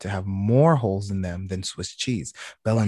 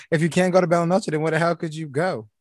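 lightly voiced woman's line, a bit higher; mildly imitating her, pitch up; quoting her; 6 semitones higher, 3 dB louder than usual. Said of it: "That's why she said".

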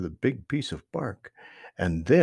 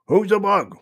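higher, louder, crosser demand; crosser than ever; 9 semitones higher, 8 dB louder than usual. A narrator's reading. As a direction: snap the question out, really cross now. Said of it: "more crossly than ever before".

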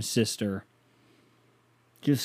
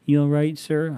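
higher, louder kind staff check-in; little girl worker; 4 semitones higher, 4 dB louder than usual. Retold or imitated. Skating girl checks on the fallen man, kindly and higher. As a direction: rink girl asking if the fallen man is okay. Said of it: "little girls didn't skate over that works there and go".

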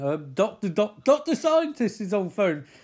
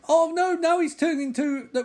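usual speaking voice

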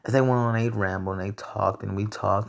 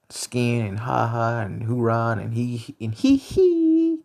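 [name] yelps; slower than usual. Retold or imitated. Mimicking [name]'s vocal ad-libs.

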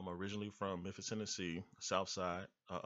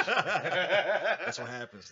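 laughing; 9 semitones higher, 12 dB louder, much slower than usual. Real, not acted. Laughing reply.

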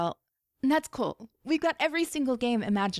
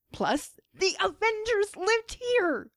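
awed; 12 semitones higher, slower than usual. A narrator's reading. Kamala's awe.

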